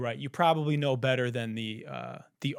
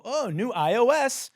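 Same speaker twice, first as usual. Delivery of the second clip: mock Apple announcement; 10 semitones higher, 5 dB louder than usual. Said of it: "they go".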